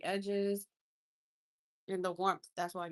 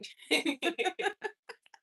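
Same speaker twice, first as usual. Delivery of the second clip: delighted laugh; 9 semitones higher, 6 dB louder than usual. Real, not acted. Laughing at eggshell joke.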